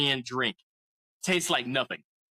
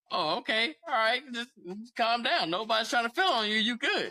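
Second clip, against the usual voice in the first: calming reassurance; 8 semitones higher than usual. Retold or imitated. Calming her down, high pitch.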